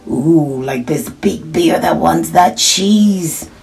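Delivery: usual speaking voice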